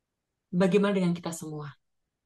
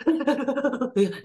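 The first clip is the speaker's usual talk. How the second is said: rapid high stuttering sobs, acted out; imitated shaking sobs; 8 semitones higher, 4 dB louder than usual. Imitating a friend crying like an earthquake, heaving mock sobs. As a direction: mimic sobbing that shakes the whole body. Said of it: "nangisnya itu ya kayak".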